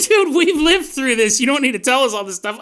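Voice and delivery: a listener cutting in, exasperated. Acted. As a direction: complain like a fed-up friend. Listener protests the history lesson.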